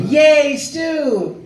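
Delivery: high, drawn-out cartoon cheer; Maxie Whitehead as Al. Imitating the new Al's expected line, high happy cheer. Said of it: "Maxie Whitehead go".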